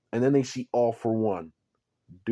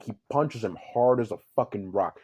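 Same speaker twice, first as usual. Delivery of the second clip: usual speaking voice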